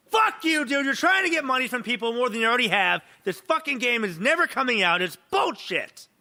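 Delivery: high, heated outburst; angry rant. Real, not acted. Podcast host ranting at a game dev, angry. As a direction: blow up at him in disgust.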